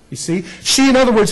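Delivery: normal talking voice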